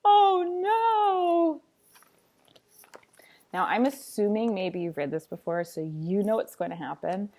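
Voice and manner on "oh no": high cry of alarm; mock-horrified oh no; dramatic dismay. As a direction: gasp out the disaster. Tree falls in story.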